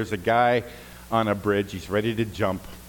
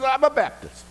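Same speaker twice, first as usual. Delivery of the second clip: high, thrilled squeal; excited echo; 13 semitones higher than usual. Retold or imitated. Rescuer squealing in delight at a fellow Baptist. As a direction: shriek it back with joy.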